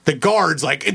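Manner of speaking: ordinary talk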